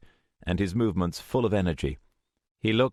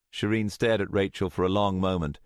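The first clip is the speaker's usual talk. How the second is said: usual speaking voice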